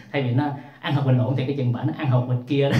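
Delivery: barely holding back laughter while talking; laughing. Real, not acted. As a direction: chuckle through the punchline. Laughing through the risqué punchline. (vừa nói vừa cười)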